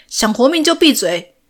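high, loud threatening yell; loud shout. A narrator's reading. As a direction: bark it out as a threat. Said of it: "大声喊着". (大声威胁地吼)

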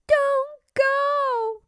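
put-on very high child's plea; pleading little princess. Princess asking the king to stay, pleading. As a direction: beg in a little girl's high voice.

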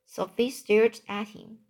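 ordinary delivery, nothing marked

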